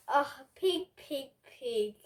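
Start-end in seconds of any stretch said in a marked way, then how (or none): none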